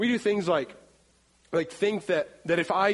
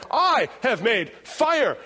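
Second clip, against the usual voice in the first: slow, higher, louder triumphant Castaway cry; Tom Hanks impression; 4 semitones higher, 5 dB louder, slower than usual. Preacher quoting Castaway to the congregation, slow triumphant shout. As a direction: proclaim it slowly like a proud castaway. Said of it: "Tom Hanks on the beach has the fire".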